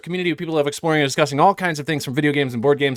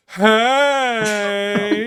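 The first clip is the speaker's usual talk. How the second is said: a higher, far-carrying "Hey" across the street; 5 semitones higher than usual. Retold or imitated. Neighbour booming hello from across the street. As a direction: call it over like the neighbour does. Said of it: "he'll be like".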